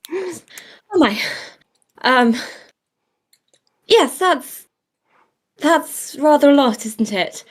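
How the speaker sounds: high, prim, startled voice; flustered genteel voice. Acted. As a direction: play it prim and rattled. Flustered character reaction.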